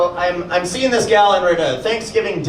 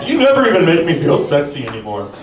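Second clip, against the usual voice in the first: put-on whiny boyfriend; 3 semitones higher than usual. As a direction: whine like a neglected partner. Comedian voices imagined whining boyfriend.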